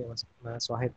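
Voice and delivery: usual speaking voice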